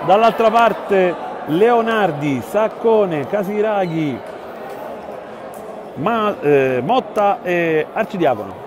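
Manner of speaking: measured basketball commentary, building tension; live play-by-play. Real, not acted. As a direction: call the final seconds steadily.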